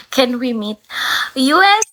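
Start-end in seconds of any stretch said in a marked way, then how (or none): 1.4-1.9 high, loud cheer; loud, high-pitched shout-out; calling out. Real, not acted. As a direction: call out the viewer's name with a whoop. Calling out a viewer's name.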